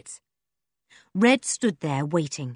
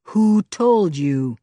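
slow, louder menacing demand; threatening; 6 dB louder, much slower than usual. A narrator's reading. Red looms over Julian, slow and loud. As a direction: lean in and drag every word out. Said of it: "Red took a threatening step".

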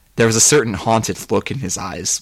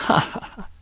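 breath-only staccato laugh; evil, voiceless ha-ha; dry, acted villain laugh; villainous chuckle. Villain laughs at his crime.

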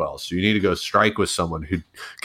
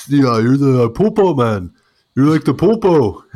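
imitating Cuban friend; 7 semitones higher, 8 dB louder than usual.

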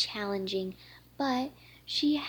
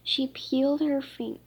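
plain reading voice

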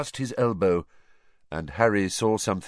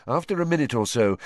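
plain reading voice

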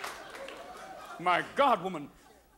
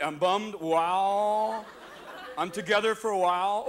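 Californese. Acted. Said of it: "eighteen words in Californese".

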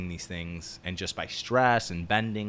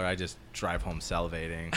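normal talking voice

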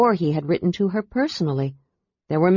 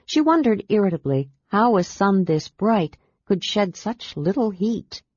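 usual speaking voice

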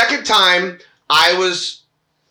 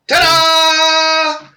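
high, loud showman's reveal; mock ta-da; 9 semitones higher, 4 dB louder than usual. Mimicking a stranger's surprise pic, mock showman reveal. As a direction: announce it like a magic trick.

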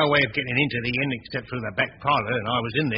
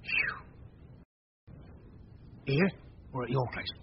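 low, much softer, secretive; hushed conspiring; 3 semitones lower, 6 dB quieter than usual. Acted. Innkeeper plotting with accomplice, hushed and secretive. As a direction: keep it down so nobody hears.

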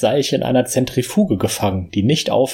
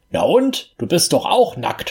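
sharp high retort; scolding; 6 semitones higher than usual. A narrator's reading. Old man scolds his naked younger self, mildly indignant. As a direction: snap back in mild scolding. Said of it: "schimpfte der Alte".

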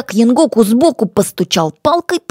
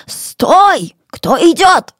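higher-pitched challenge, tense with nerves; hoarse nervous shout; 9 semitones higher, 3 dB louder, much slower than usual. A narrator's reading. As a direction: call out a sharp challenge through nerves. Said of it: "крикнул хриплым от волнения голосом".

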